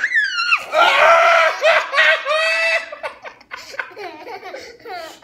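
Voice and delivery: very high, loud squealing laughter; long shrieking laugh. Real, not acted. Parent seeing the next coupon, shrieking with laughter. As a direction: scream with laughter, drawn out.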